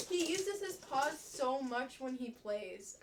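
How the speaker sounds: normal talking voice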